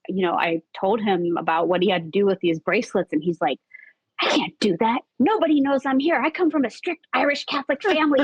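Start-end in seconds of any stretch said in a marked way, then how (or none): 4.2-8.2 high, rapid protest; retold yelling match. Friend refusing to carry the bracelets, retold high and agitated. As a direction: object in a hurried, raised pitch. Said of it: "we just had to yell at each other a little bit".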